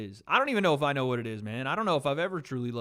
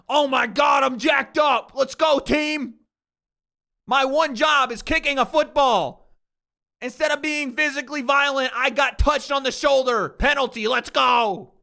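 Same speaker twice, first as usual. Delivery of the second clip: much higher and louder, hammed-up celebrating jock; mocking celebrating kicker; 11 semitones higher, 10 dB louder than usual. Streamer mocking a punter who celebrates a penalty, high and loud. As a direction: ham up a dumb gloating cheer. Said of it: "Look at the punter get up and fucking celebrate".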